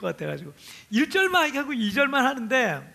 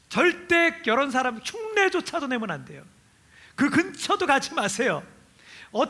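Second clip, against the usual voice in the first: usual speaking voice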